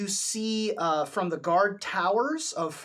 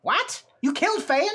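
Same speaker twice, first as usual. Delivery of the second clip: shocked; 10 semitones higher, 5 dB louder than usual. Acted. NPC shocked at news.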